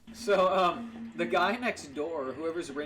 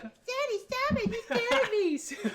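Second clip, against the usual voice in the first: very high-pitched mock child wail; screaming daughter; 4 dB louder, much slower than usual. Daughter begging her dad to stop, squealing. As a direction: plead loudly in an exaggerated little-girl squeal. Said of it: "his daughter's like".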